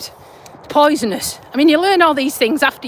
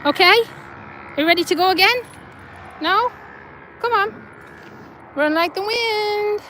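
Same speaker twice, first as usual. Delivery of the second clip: higher sing-song voice coaxing a pet dog; 6 semitones higher, 3 dB quieter than usual. Real, not acted. Owner urging her dog Blue on the walk, sing-song coaxing. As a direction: cheer the dog on to run, a little higher.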